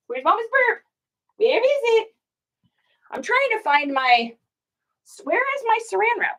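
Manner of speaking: high sing-song voice to the dog; pet talk. Real, not acted. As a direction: coo at the pup while searching. Talking to the dog.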